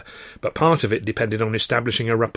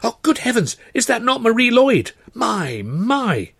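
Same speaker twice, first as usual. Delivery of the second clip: high, breathless star-spotting; star-struck gent; 9 semitones higher than usual. A narrator's reading. Luscombe spotting music-hall stars in the bar, thrilled. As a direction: point out each famous face in delight.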